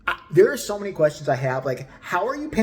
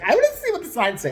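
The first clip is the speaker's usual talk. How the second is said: higher, louder, fast eager voice; mock boyfriend; 10 semitones higher, 6 dB louder, faster than usual. Narrator imitating boyfriend Steve wanting to read signs, mock eager voice. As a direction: play him as a curious kid.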